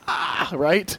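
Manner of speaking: usual speaking voice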